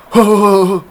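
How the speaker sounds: loud high ho-ho-ho chuckle; knowing old man's chuckle; old monk's chuckle. Retold or imitated. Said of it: "一直点头说".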